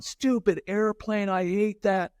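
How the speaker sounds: high, slow, whiny mock tantrum; mock kid tantrum. Kid whose paper airplane flopped, whining a high, slow mock tantrum. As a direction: play a fuming little kid.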